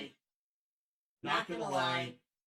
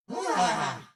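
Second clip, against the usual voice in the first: startled outburst; 11 semitones higher, 8 dB louder than usual. Real, not acted. Host reels after the call.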